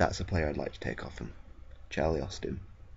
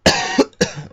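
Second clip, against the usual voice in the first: harsh cough; 11 semitones higher, 15 dB louder than usual. Real, not acted. Sudden cough mid game.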